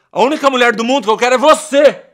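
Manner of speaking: loud, high pleading yell; desperate shout. Acted. Boyfriend to his ex, desperate loud shout. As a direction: beg her back at full volume.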